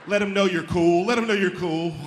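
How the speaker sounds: big, eager-to-please catchphrase; showy reassurance. Acted. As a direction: belt it like a shuffle-dance routine. Acts out the shuffle routine.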